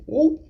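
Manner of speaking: short, high-pitched "whoop"; high little exclamation of reaction; startled whoop. Retold or imitated. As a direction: let out a quick high whoop of surprise. Startled reaction to a retold line.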